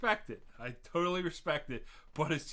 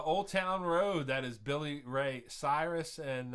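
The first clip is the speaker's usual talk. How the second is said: usual speaking voice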